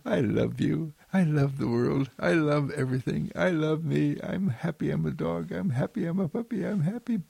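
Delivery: a gleeful little puppy gushing love; happy puppy. Pretend. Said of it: "The puppy dog wags its tail and says".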